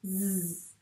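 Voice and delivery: Z as a long buzzing zzz; Z sound. Shown as a demonstration. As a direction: buzz out Z like a bee. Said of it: "On a prononcé ce S". (Z prononcé comme un zzz prolongé)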